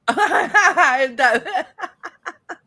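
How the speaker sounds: loud high laugh; burst of laughter. Real, not acted. Laughs at [name] question.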